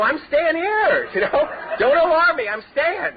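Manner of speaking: high, slow, laughing mock insistence; laughing teen self. Insisting to his buddy he stays in jail, high and laughing. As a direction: drag it out high through a laugh. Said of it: "I go".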